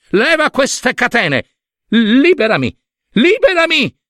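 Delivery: panicked imperious yell; frantic command. A narrator's reading. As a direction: cry out, building to a high shout. Chained Hera orders son.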